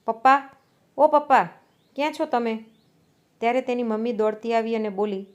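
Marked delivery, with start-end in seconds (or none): 0.0-2.6 narrated shout for a parent, louder at first; raised-voice call, a little higher; calling out loudly. A narrator's reading. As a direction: call out for dad, then ease off. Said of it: "જોરથી રાડ પાડીને બોલી".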